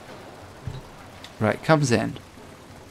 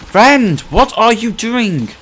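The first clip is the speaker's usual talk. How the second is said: high, loud, drawn-out plea; exasperated calling; 10 semitones higher, 5 dB louder, much slower than usual. Pretend. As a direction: call out in mock despair. Exasperated at teammate's mistake.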